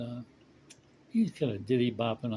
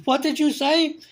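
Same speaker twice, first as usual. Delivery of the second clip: much higher and louder, in a put-on old lady's voice; outraged old lady; 10 dB louder than usual. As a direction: shriek the question back at him. Old lady answers drunk's insult.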